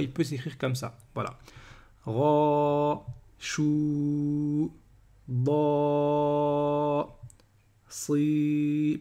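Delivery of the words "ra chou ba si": RA, CHOU, BA, SI each held long on a monotone; long vowels. Shown as a demonstration. Teacher drilling long vowels for learners, held long. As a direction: chant "ra", "chou", "ba", "si" with stretched level vowels. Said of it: "on passe aux voyelles longues".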